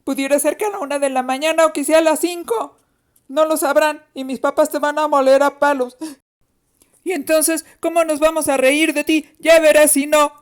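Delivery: put-on fast, high-pitched kid threat; defiant boy. A narrator's reading. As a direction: rattle off the threat like a cocky little brother. Boy threatens his older sister.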